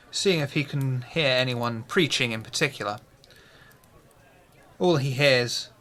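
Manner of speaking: ordinary talk, nothing marked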